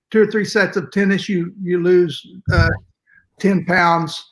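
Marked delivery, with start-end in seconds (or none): none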